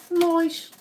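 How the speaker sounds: a squeaky cartoon voice telling viewers about his viral video; silly high cartoon voice. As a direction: talk like a goofy cartoon character.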